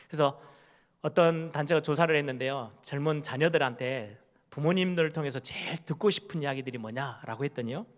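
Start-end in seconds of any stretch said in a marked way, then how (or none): none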